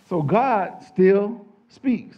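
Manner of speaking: slow, loud and weighty; a preacher declaring to the congregation; preaching emphasis. Acted.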